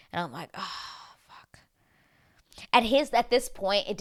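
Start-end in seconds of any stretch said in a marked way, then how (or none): 0.5-1.6 drawn breathy whisper, fading out; hushed dismay; whispered curse. Retold or imitated. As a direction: let it sink away in a whisper. Retold sinking reaction.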